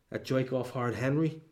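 ordinary talk, nothing marked

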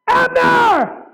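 very high, loud shouted name; sudden full-voiced high yell; calling out. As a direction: holler the name to summon someone. Acts out God calling a name.

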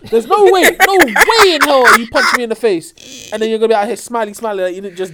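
loud, high indignant rant; fired-up disbelief, half laughing; heated outrage. Real, not acted.

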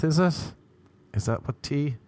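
normal talking voice

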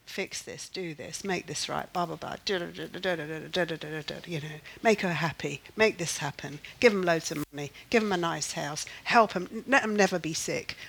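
low, mumbled mock prayer list; rote prayer imitation. Mimicking a worrier's instinctive prayers, by rote. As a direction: rattle off wishes in a low, dismissive drone. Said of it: "not the way that instinctively is coming out".

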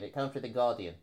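soft, put-on English accent; amateur English accent, played for fun. Shown as a demonstration. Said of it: "read it in English accent".

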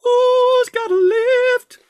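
sung high and loud segment title; big showbiz intro for the lift segment; announcer jingle. Acted. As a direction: belt the segment name like a jingle.

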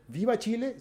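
high-pitched rallying cry; grito de viva. Acted. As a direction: call it out like a patriotic cheer. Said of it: "cuando uno dice". (viva patriótico en tono agudo)

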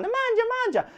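high mock Italian mamma urging food; Italian mum. Parents urging a full son to eat, high mock mamma. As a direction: sing it out like a pushy nonna.

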